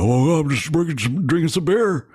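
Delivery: Steve Austin. Retold or imitated. Fan retelling a phone call with the wrestler, bad drunk impression. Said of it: "the way I just did it, which is really bad".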